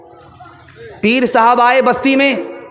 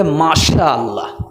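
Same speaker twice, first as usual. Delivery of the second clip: reverent murmur; 7 semitones lower, much slower than usual. Pretend. Bride answers about father-in-law.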